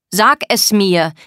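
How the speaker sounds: loud, drawn-out order; forceful demand. Acted. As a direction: press each word on the listener. Er drängt sie wütend. (laut fordernd)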